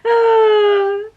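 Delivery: loud, high, drawn-out "oh"; teary wail. Real, not acted. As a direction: cry out, half laughing, half tearful. Overcome looking at engagement photos.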